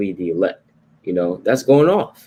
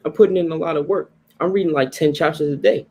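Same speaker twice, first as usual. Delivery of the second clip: higher-pitched imitation of a boaster; mock self-exalting boast; 7 semitones higher than usual. Preacher imitating believers who exalt themselves, in a higher boastful voice. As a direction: lightly act out someone bragging, voice raised in pitch. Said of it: "You're not wise if you say".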